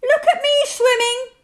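very high, loud little squeak; excited squeak. A narrator's reading. Roo shows off from the pool, squeaking. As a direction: squeak it out proudly. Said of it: "squeaked Roo".

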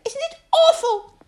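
very high, loud squeak; alarmed tiny pig voice; frightened Piglet. A narrator's reading. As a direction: squeal it in dread. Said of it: "said Piglet".

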